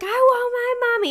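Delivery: put-on high, wailing little child; scared child. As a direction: cry out for mommy like a frightened kid. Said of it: "And she's like".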